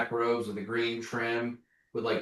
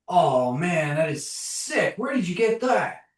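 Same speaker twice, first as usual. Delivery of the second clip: higher-pitched, excited admiration; impressed gushing; 7 semitones higher, 4 dB louder than usual. Acted. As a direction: gush like an awestruck fan. Gushing character rushes up, admiring.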